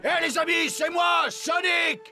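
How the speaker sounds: calling out. Acted. Calling to friends.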